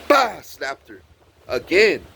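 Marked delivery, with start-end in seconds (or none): 0.1-0.4 high, sudden "bah"; imitated slap noise. Storyteller acting out another slap, explosive noise. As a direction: punch out a smack sound.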